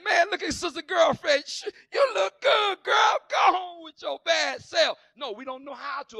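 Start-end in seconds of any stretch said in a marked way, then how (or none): 0.0-5.0 high, loud delighted praise; applauding friend. Retold or imitated. Churchgoer hyping a dressed-up sister, loud and thrilled. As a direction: cheer her on, thrilled. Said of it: "instead of just saying".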